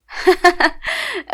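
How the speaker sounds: high, loud scornful laugh; mocking laugh. Cousin laughs off her anger, high mocking laugh. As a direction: laugh at her in open mockery. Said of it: "ضحك وقال لي".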